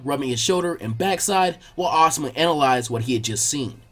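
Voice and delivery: usual speaking voice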